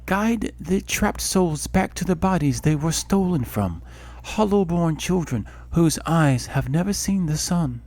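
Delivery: a goddess warning of tragedy; solemn goddess voice. Acted. Helia's voice returns on wind.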